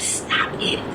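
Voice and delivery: hushed firm whisper; whispered warning. Retold or imitated. Aide whispering to the disruptive girl to stop. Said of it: "I said".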